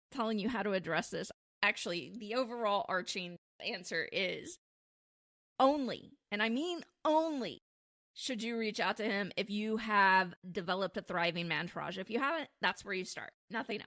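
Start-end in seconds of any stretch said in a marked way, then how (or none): 5.6-7.6 both times ONLY jumps high, then falls; stress on ONLY. Real, not acted. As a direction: land hard on "only" with a high fall. Emphatic repeated condition.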